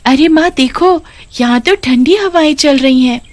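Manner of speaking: a happy daughter out walking with her mother; little girl. Acted. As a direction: chirp it like an excited young girl. Narrator voices the little daughter.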